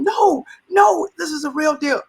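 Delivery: high, pressing shout of excitement; urgent excited insistence. Retold or imitated. Boss chasing the COO, insisting to staff it's real.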